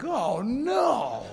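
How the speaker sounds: drawn-out swooping wail of dismay; mock dread. Seatmates seeing big passenger approach, mock dread. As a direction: imitate comic horror, pitch sliding up and down.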